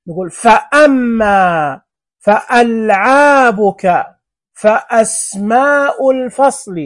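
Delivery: slow, with a sharp catch after فأ; clear glottal stop. Shown as a demonstration. Teacher to pupils, showing the break after fa. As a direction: stop the voice at the hamza in فأما, slowly. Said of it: "لاحظ بين فأ".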